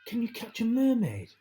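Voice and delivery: put-on childlike wish. Child's wistful question.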